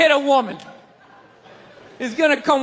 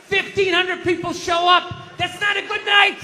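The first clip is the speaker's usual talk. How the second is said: ordinary delivery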